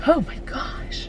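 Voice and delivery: hushed, slow whisper of surprise; whispered oh my gosh. Real, not acted. Alone in the car, startled by something, whispering. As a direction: breathe it out under your breath, amazed.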